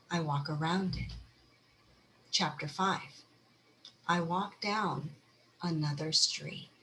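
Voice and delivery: soft, measured reading of a short poem. Acted. Speaker reads the five-chapter poem to the audience, soft and measured.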